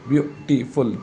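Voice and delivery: Beautiful split into three distinct beats; beautiful sounded out in three parts for a class; syllable by syllable. Shown as a demonstration. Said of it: "तीन syllable word".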